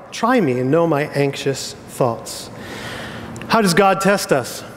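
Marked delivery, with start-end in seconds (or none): none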